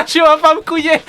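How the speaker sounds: high, loud, laughing through the words; protestation hilare. Real, not acted. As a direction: protest while cracking up. Éclate de rire en protestant. (protestation aiguë en plein fou rire)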